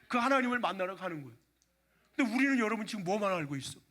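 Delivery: ordinary talk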